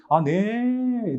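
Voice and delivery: high, long-drawn mock-startled answer; surprised reply. Kids getting the new pastor's first call, surprised. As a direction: imitate a taken-aback kid, drawing it out high. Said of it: "이렇게 놀라는 거예요".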